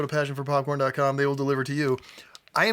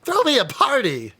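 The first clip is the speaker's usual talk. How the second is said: mock excited; 10 semitones higher, 6 dB louder than usual.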